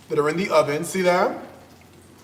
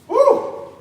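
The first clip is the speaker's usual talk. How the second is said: very high, loud joyful woo; excited whoops; 4 dB louder, much slower than usual. Real, not acted. Cook thrilled by his recipe, loud high whoops. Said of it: "I done got excited".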